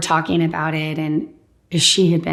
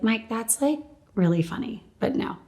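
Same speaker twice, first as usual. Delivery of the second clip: softer, dry, gently shutting him down; soft amused refusal; 5 semitones higher, 7 dB quieter than usual. Retold or imitated. Patient gently shuts down her priest, quietly amused. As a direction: let him down softly but firmly.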